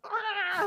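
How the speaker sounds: high, loud "ah"; hammed-up cry of pain; mock pained cry. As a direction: yelp as if the admission hurt. Pretend pain at own admission.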